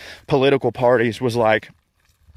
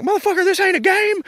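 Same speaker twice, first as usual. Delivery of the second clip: furious outburst. Retold or imitated. To people flippant about politics, high angry yell. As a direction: explode at them in a high yell.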